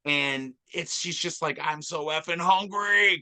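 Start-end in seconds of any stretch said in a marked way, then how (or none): 1.6-3.2 lightly put-on Southern accent, a bit higher; a hint of a hungry Southern woman. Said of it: "she's got that Southern accent".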